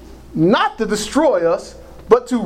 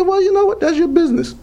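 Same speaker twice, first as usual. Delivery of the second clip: higher-pitched, offhand comeback; breezy dismissal; 9 semitones higher, faster than usual. Retold or imitated. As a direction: brush the critic off, pitched up. Quotes own reply to critics.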